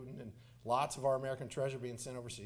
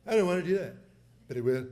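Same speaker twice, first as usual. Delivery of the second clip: louder presidential impression; Donald Trump; 6 dB louder, faster than usual. President finishing his threat to the Russian leader, impersonated. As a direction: land the threat firmly as the former president. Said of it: "I'll do it in his voice".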